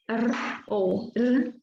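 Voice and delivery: "R" as a short tongue-tip tap or trill; soft R. Shown as a demonstration. Teacher demonstrates the R brando phoneme, soft tapped R. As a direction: sound each "R" alone, light and tongue-tip, drawn slowly. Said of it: "chamado de R brando".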